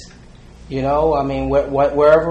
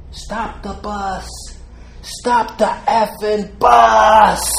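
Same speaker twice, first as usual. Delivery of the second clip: slow, high mock yell that swells louder; retold scream; 8 semitones higher, 6 dB louder, much slower than usual. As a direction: bellow it out like stopping a runaway bus. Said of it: "Catfish Hunter screams out".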